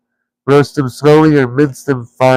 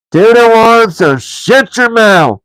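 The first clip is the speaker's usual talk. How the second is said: high, loud troll threat, slowing at the end; troll Bert silencing William; angry troll; 10 semitones higher, 4 dB louder, slower than usual. A narrator's reading. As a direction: shout him down. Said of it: "said Bert".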